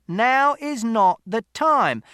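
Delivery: high, slow, loud command; interrupting shout. A narrator's reading. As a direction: shout each word to break it up. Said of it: "Mister Shears shouted".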